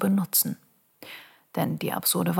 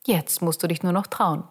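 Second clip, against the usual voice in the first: ordinary talk, nothing marked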